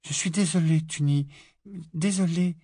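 pleading apology. A narrator's reading. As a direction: beg her sister's forgiveness. Lily pleading with sister. (Lily implore sa sœur, suppliante)

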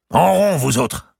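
captain ordering soldiers into a ring; calling out order. Acted. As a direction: bark the soldiers into a circle. Gournay commanding soldiers.

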